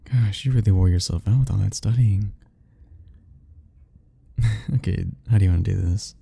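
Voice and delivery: usual speaking voice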